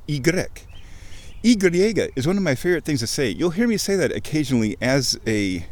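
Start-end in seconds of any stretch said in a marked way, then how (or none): 0.1-0.5 Y said as "ee-GREK"; French name. Shown as a demonstration. Recalling the French letter name, Y as ee-GREK. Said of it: "like in French".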